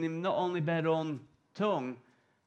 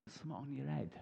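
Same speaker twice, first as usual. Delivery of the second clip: hushed aside; 3 semitones lower, 13 dB quieter, faster than usual. Acted. Peter notices fire on head.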